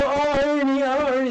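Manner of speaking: high, loud mock fan squeal; swooning female fans. Host teases singer about his lady fans, high mock swooning. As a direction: imitate adoring ladies calling out. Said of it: "They go".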